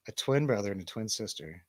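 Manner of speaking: normal talking voice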